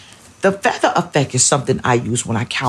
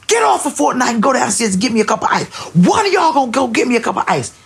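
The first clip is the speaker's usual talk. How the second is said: fast, higher furious mom shout; blacked-out yell; 9 semitones higher, faster than usual. Retold or imitated. Mother kicking in the door, yelling at son about ice, furious. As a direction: blow up at the kids, fast. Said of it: "I blacked out. I kicked in the door".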